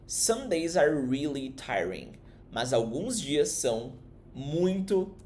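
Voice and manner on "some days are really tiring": slow model line. Shown as a demonstration. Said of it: "mas, but".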